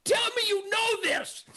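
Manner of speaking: high-pitched, exasperated cry; incredulous outburst. Retold or imitated. Hunter challenging sushi-eating critics, incredulous outburst. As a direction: burst out, pitch jumping way up. Acted rant at his critics peaks.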